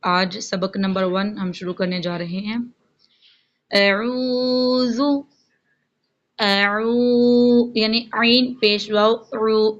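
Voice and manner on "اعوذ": اعوذ with عین drawn out long, Quranic recitation; lengthened ain. Shown as a demonstration. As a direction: stretch the عین in اعوذ slowly. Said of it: "عین کو لمبا کریں گے". (اعوذ میں عین کو لمبا کر کے)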